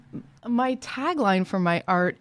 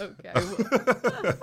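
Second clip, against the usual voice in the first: laughing; 6 semitones higher, slower than usual. Real, not acted. Laughs at the challenge.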